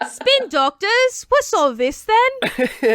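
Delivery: put-on high, slow, puzzled British accent. Pretend singer finds podcast via Google alert, mock British. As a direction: stretch it out in an exaggerated British accent. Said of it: "she'll be like".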